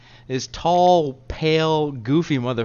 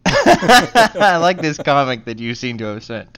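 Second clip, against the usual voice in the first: talking through a loud laugh; 4 semitones higher, 9 dB louder than usual. Real, not acted. Amused host tells co-host about the comic he sent, laughing. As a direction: crack up, higher and louder, while speaking.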